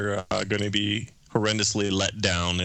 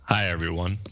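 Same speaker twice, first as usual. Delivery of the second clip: sultry voice; 3 semitones lower than usual. Pretend. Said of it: "Can we get a little taste of that sultry voice".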